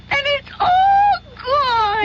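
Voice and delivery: slow, high, whimpering wail over the empty bottle; mock crying. Goldilocks finds her diet soda drunk, mock crying. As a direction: sob it out like a cheated child.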